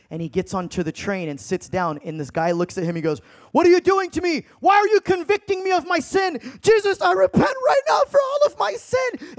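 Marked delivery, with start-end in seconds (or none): 3.5-9.3 loud, high-pitched cry of conviction; acted-out desperate shouting; anguished shout. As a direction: cry out in repentance at the top of your voice. Convicted train passenger cries out repenting.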